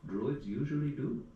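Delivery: put-on a touch of a throaty Indian accent; Indian subcontinent accent. Possessed barmaid addressing druid, sudden Indian accent. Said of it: "her voice became throatier as she spoke with a newfound accent".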